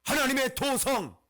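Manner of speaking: loud, high, measured declamation; ringing full-force preaching; forceful proclamation. Acted. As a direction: hammer each phrase out from the pulpit.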